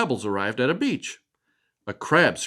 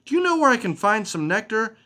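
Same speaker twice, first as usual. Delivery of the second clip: high butterfly voice; 9 semitones higher than usual. Acted. Butterfly Pebbles asks for nectar.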